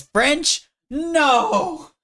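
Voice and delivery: high, loud disbelief; loud, high-pitched cry of disbelief; shocked outburst. Acted. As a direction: yell it in shocked protest. Shocked to learn he's French.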